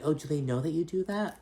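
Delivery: mock Dorit. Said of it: "Dorit's like".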